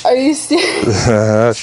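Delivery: slow, low, stunned; can't believe her eyes; real shock at the gift; stunned disbelief. Real, not acted. Wife sees the surprise car.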